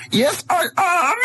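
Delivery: hammed-up German villain accent, high and slow; movie villain german accent. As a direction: bark it like a cartoon Nazi. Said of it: "doing the, uh, Nazi accent very well".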